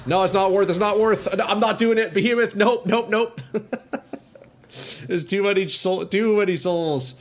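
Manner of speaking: high, panicked retreat; scared. Real, not acted. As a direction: back off in a panic. Too many souls, retreating.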